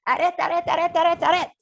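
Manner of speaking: plus haut et plus fort, en criant; brigadier qui crie. Shown as a demonstration. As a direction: stoppe la pensée comme un brigadier. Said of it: "son petit brigadier qui passe". (brigadier criant arrête, arrête)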